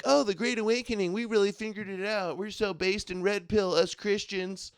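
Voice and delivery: high-pitched mocking voice; mock self-congratulation in a high, lighter voice. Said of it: "they're like".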